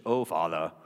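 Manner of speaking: low mock-pious English accent. Preacher mocking religious prayer, English accent. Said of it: "where did that English accent come from".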